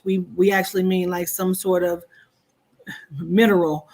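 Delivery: normal talking voice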